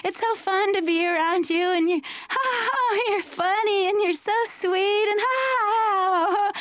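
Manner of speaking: high, giddy, giggling gush; new girlfriend gushing over her partner, giddy and high. Pretend.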